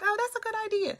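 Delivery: high-pitched mock realization; impressed reaction. Streamer recalling seeing others' replay comments, high impressed reaction. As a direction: act out liking an idea, voice jumping up.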